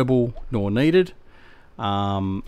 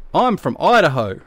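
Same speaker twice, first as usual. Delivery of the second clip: mock American; 6 semitones higher, 5 dB louder than usual. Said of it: "And he goes".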